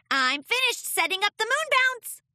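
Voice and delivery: high squeaky little voice; squeaky filly. Acted. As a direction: squeak it out excitedly. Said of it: "Sweetie Belle squeaked".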